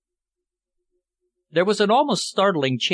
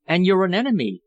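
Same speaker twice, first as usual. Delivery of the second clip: protesting; 6 semitones higher, 3 dB louder, faster than usual. A narrator's reading. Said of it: "Philippa protested".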